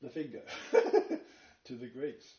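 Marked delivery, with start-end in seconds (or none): 0.5-1.2 laugh. Real, not acted. Mocking scholars' claim.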